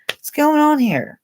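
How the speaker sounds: ordinary delivery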